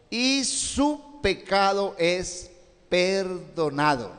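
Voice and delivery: "y su pecado es perdonado" very slow, each word weighed; emphatic. Real, not acted. Preacher stressing forgiveness to the congregation, slow and emphatic. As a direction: stretch out "perdonado" slowly for emphasis. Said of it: "Yo me enfatizo mucho esa palabra".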